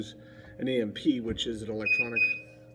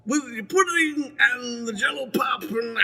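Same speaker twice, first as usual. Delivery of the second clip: much higher, louder, slow pudding-ad voice; Bill Cosby impression; 14 semitones higher, 5 dB louder, slower than usual. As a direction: ham up the pudding pop pitchman. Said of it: "my Bill Cosby impression".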